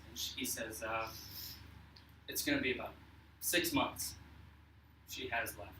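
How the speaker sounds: a quiet, grave doctor breaking bad news; lightly voiced doctor, gentle and heavy; somber doctor.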